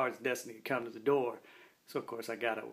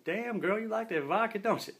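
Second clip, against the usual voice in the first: mock-impressed tease, pitched way up; impressed teasing; 10 semitones higher, 4 dB louder than usual. K nine teasing Destiny about her big vodka swig, high and playful.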